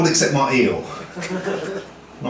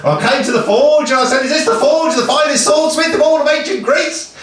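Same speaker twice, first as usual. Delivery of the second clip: higher, projected mock-epic declamation; grand storytelling; 7 semitones higher than usual. Achilles asking at the forge, grand storytelling. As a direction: call out like a hero at the gate.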